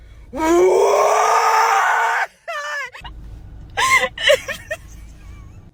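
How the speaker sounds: loud, drawn-out shrieking howl; long high wail. Acted. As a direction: wail at length on a high note. Customer reacts to bad news.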